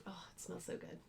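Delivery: soft whisper. Real, not acted. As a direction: sigh it out unvoiced. Smelling the dyed fabric.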